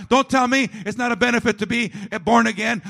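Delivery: normal talking voice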